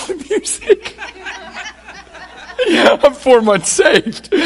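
slow, amused and laughing through it; chuckling at how new he was. Real, not acted. Telling the congregation about his newness, laughing slowly.

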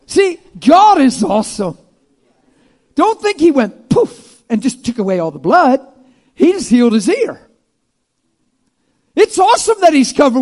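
loud, high exclamation; full-force preaching. Real, not acted. Preacher praising God to the crowd, loud and high. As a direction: proclaim it with fire.

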